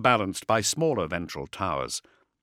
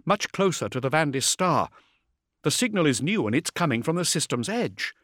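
brisk, higher female officer; vox officer voice; 7 semitones higher than usual. A narrator's reading. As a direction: report it like a busy comms officer.

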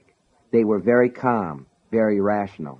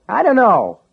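irritated; 12 semitones higher, 7 dB louder than usual. Acted. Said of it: "sounded irritated".

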